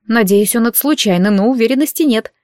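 ordinary delivery

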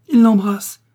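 high, loud jealous roar; jealous wife roaring at the station; furious roar. A narrator's reading. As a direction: explode with rage. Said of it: "rugit Léocadie".

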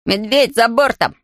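mild cry of alarm; yelling the alarm. A narrator's reading. Boy sees the bear overboard, yells a mild alarm. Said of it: "завопил Джонатан".

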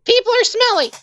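very high, complaining character voice; grumpy shut-in. Acted. Resident turning down the investigators' offer, high grumpy voice. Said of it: "you hear from the inside".

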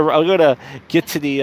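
ordinary talk, nothing marked

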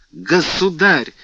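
a high, slow, drawn-out plea; desperate cry. A narrator's reading. Kneeling girl crying out to the king in despair. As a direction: call out his title as if begging. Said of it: "воскликнула в полном отчаянии Лавальер".

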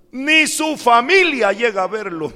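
high, loud indignant quote; acted outburst of dismay; emphatic retold complaint. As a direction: raise pitch and volume to drive it home.

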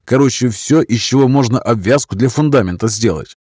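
eager sergeant voice. Acted. Caucasian sergeant speaks, eager.

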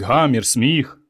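ordinary delivery, nothing marked

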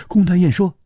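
usual speaking voice

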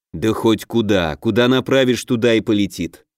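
ordinary delivery, nothing marked

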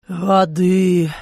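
lightly voiced croaking plea; hoarse croak. A narrator's reading. Hungover German begging the woman for water, hoarse. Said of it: "прохрипело немецкое исчадие".

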